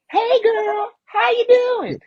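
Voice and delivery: high, lively imitation of an actress greeting; imitating actress. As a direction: squeal it out as the actress from Friday. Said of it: "She was on Friday".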